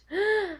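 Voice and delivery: high startled gasp; father gasping at the idea; sharp gasp. Acted. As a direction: gasp in shock. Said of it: "Flora's father gasped".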